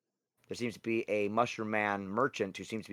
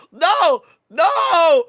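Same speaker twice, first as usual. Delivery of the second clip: very high, loud, drawn-out cries; freaking out; 15 dB louder than usual. Acted. Pickle Juice recoils from the rats, freaking out. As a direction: wail it high in mock horror. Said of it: "she starts freaking out".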